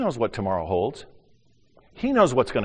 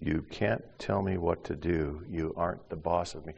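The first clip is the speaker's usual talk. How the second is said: quiet echo; 3 semitones lower, 7 dB quieter than usual. Retold or imitated. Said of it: "say the same kinds of things".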